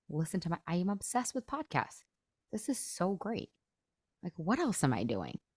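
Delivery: soft, musing inner monologue; contented self-talk. Acted. Coach voices her own thoughts about dishes, softly contented. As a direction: quietly talk yourself into loving the chore.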